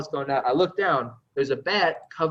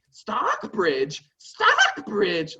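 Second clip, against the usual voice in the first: incredulous trooper; 11 semitones higher, much slower than usual. Retold or imitated. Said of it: "he's like".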